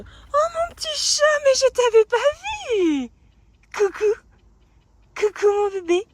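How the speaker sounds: high, loud cooing to the cat. Real, not acted. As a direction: coo sweetly to a pet. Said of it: "vous avez entendu ma voix de gaga".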